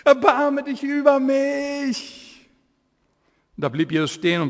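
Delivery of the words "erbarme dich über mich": much higher, slow drawn-out plea; crying out more. Acted. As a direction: stretch the plea out, begging harder. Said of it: "er aber rief noch viel mehr".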